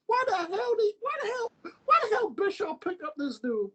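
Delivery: high, cracking near-shout; amazed fan. Retold or imitated. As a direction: yelp the question in disbelief. Fan reacting to Rey Mysterio.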